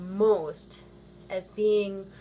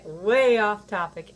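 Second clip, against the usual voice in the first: ordinary delivery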